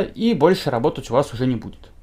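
usual speaking voice

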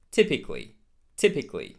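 TYPICALLY in three syllables, "TIP-ik-lee"; correct typically. Shown as a demonstration. Teacher modelling typically for learners, A left silent. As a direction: drop the A after C in "typically". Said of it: "very similar to these previous two".

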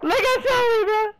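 very high, loud laughing delight; delighted squeal. Real, not acted. Fan spotting Sully on screen, delighted high squeal. Sees beloved old character Sully.

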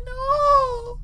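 long, high wail of "no"; pleading, high-pitched groan of dread; dismayed wail. Real, not acted. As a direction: cry out in drawn-out dismay. Dreads replaying a familiar game section.

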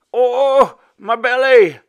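high, loud cry of pain; agonized wail. A narrator's reading. Stricken father to his son, wailing in pain. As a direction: wail it out at full volume. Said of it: "he wailed".

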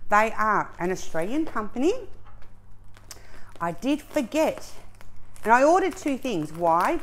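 ordinary delivery

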